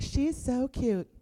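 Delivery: put-on high, slow baby-admiring coo; mock cooing. Strangers admire her baby before questioning, mock cooing. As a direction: imitate strangers gushing over a baby.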